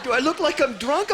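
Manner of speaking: high, loud worried question; anxious drunk uncle. Acted. Drunk uncle asks nephew before cops come, high anxious voice.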